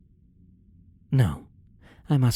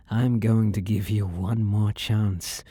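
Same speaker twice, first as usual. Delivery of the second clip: usual speaking voice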